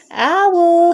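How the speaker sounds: loud high shout of his own name. A narrator's reading. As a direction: yell it down the stairs, loud and high. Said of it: "he shouted".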